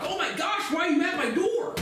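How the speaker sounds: hammed-up startled squeal at the door; mock shriek. Mocking the social workers at his door, high mock shriek.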